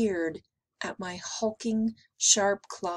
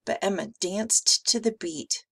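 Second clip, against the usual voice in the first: ordinary delivery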